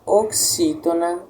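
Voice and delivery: normal talking voice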